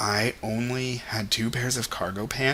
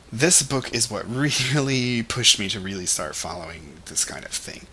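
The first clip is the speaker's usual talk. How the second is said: ordinary talk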